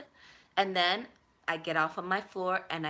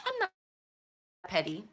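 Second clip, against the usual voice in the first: normal talking voice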